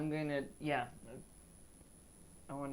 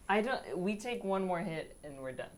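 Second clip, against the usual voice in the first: ordinary talk, nothing marked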